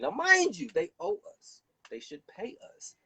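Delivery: very high, pointed aside. Real, not acted. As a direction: cut in high to stress the point. Sharp aside emphasis.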